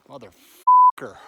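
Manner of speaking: huge drawn-out roared curse; real furious slow bellow; furious shout. Real, not acted. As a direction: bellow it at the bear, slowly. Yelling after bear charge.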